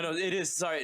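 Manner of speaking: normal talking voice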